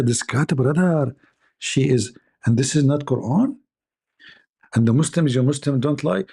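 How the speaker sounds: normal talking voice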